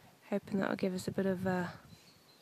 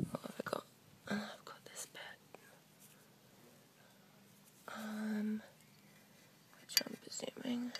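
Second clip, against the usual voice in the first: hushed whisper; 14 dB quieter than usual. Real, not acted. Quiet self-talk over finds.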